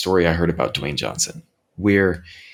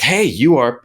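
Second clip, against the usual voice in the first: higher, louder starstruck hail; excited fan greeting; 6 semitones higher, 6 dB louder than usual. Retold or imitated. Fan spotting his favorite comedian in a restaurant, excited greeting. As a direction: call out eagerly to your idol.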